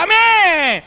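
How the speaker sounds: high, loud drawn-out amen; hammed-up old churchwoman's amen; old lady's amen. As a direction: answer amen like a devout old woman. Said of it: "La viejita dijeron".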